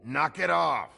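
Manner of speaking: high, loud order to stop; loud command. Acted. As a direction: bark it to end the fight. Shouts to stop the fight.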